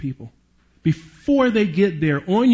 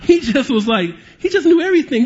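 laughing while telling it, pitched up high; 8 semitones higher, 4 dB louder than usual. Real, not acted. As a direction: chuckle through the words at the insults.

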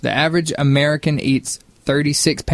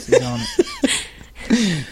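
laughing; much slower than usual. Real, not acted.

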